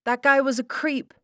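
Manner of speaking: very high, loud retort; defiant shout. A narrator's reading. Sarah shouts back at the lion tamer, defiant. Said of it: "shouted Sarah".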